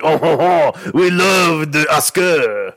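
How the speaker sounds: high, slow hammed-up French accent with a laugh; mock French. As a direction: play an over-the-top Frenchman, French accent. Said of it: "Cubans sound like the French".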